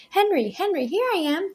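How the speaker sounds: high, calling across the crowd; girl calling to Henry. A narrator's reading. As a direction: call to him over the people. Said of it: "cried Laurie".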